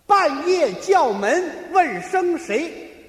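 very slow, spaced-out words; slow riddle recital. Shown as a demonstration. Partner sets a seven-word riddle, reciting very slowly.

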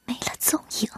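slow, then breathed away to nothing; fading to a whisper. A narrator's reading. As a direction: let the line die into a whisper. Dramatic narration fading to whisper.

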